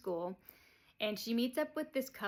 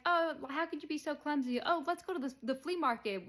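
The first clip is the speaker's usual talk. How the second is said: higher, louder falsely cheery chiding; hammed-up sugary scold and brush-off; fake fluster; 7 semitones higher, 4 dB louder than usual. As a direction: gush it out to hurry her away. Beverly covering up, rushing out.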